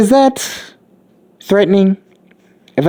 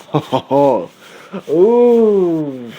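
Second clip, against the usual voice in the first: scheming chuckle; 4 semitones higher, much slower than usual. Acted. Izuku scheming to sell chocolate, a sly slow chuckle. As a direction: savor it like a schemer spotting a deal.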